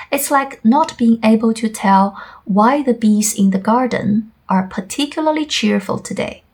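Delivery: ordinary talk, nothing marked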